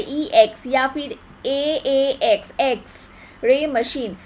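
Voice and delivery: slow "eks", opening on a vowel; vowel sound demo. Shown as a demonstration. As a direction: spell out एक्स slowly as "e-eks". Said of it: "pronunciation इस तरीके का आता है".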